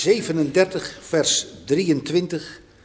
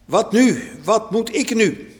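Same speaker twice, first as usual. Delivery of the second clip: panicked; 5 semitones higher than usual. A narrator's reading. Ruben panicking over missing brother.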